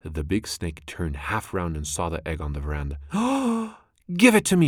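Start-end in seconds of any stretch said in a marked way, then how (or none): 3.0-3.7 very high, sudden gasp of shock; sharp gasp. A narrator's reading. Snake sees her egg. 4.1-4.7 very high, much louder desperate cry; frantic demand. A narrator's reading. Snake demands her egg.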